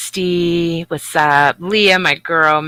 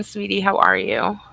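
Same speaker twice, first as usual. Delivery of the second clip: ordinary delivery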